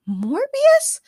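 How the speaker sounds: very high rising gasp of shock. A narrator's reading. Morgana recognizing her cousin, gasping in shock. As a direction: gasp his name in disbelief. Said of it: "Morgana gasped".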